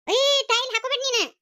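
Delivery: high, sudden scolding; sharp objection. Acted. Stopping her from wearing the necklace, sharp high protest. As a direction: cut in sharply, pitch jumping up. Snapping over who wears the necklace.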